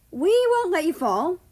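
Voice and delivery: put-on a friendly boy's promise; reassuring. A narrator's reading. Kazakhstan reassures Australia, high and encouraging.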